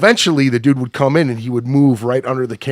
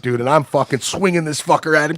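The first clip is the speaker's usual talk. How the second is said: ordinary delivery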